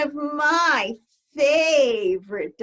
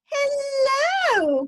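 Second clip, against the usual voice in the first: high, louder sung-out hello; called greeting; 4 dB louder than usual. Acted. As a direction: call out a friendly hello. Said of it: "called the".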